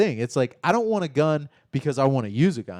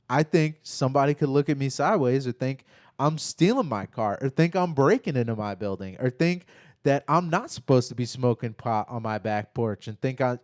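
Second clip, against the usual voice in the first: usual speaking voice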